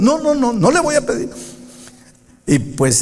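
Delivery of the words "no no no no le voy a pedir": put-on much higher, childish stubborn no; stubborn refusal.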